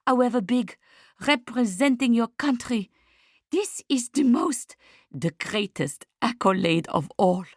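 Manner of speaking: put-on excited, high-pitched French-accented gush; Marie-Claude. A narrator's reading. Telling Lucia they want her for Venice, thrilled French accent. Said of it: "Marie-Claude's accent".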